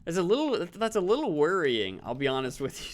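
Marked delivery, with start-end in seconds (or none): none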